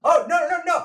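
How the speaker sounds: high, loud flustered denial; excited protest. Acted. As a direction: shoot down the objection in a squeaky rush. Birdie protests her setting's name.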